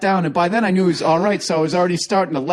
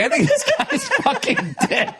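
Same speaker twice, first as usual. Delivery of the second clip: laughing. Retold or imitated. Quoting himself, laughing.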